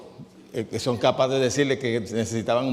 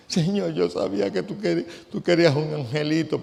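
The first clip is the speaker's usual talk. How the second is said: mock grieving prayer; 4 semitones higher than usual. Mocking a grieving parent's prayer.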